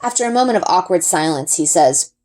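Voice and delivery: plain reading voice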